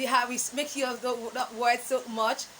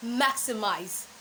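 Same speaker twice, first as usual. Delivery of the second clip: usual speaking voice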